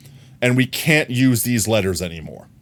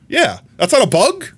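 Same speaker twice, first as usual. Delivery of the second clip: surprised; 12 semitones higher, 3 dB louder than usual. Real, not acted. Shocked Dunsparce isn't a bug.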